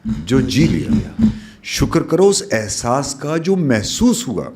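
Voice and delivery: slowing on the closing lines of each verse; poetic recitation. Acted. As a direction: recite it like a poem to an audience.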